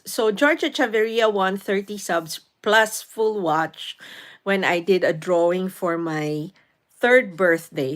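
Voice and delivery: ordinary talk, nothing marked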